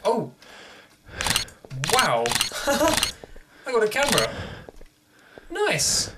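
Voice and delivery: high laughing delight; thrilled squeal. Real, not acted. Gamer finds a camera, squealing with delight. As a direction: squeal over the new item.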